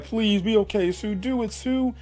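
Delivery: high, pleading mock voice; siblings pleading. Siblings urging Sue to survive, high pleading. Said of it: "they're just like".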